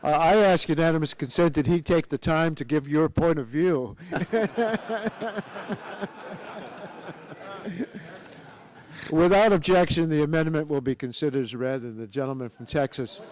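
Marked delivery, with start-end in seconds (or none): 4.0-8.2 real laughter. Real, not acted. Chairman laughs at joke.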